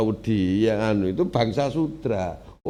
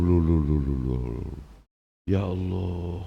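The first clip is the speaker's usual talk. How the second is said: ordinary talk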